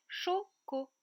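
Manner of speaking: "choco" split as cho, co; syllable by syllable. Shown as a demonstration. Teacher repeats the remaining syllables, each set apart. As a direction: separate both halves of "choco". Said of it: "il me reste".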